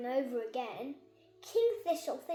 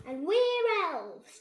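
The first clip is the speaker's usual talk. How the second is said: slow, high, louder proud cheer; 5 semitones higher, 8 dB louder, much slower than usual. Acted. Ben and Barnaby cheering to the fairies, proud. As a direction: cheer it out, chest puffed. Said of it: "cheer Ben and Barnaby together proudly".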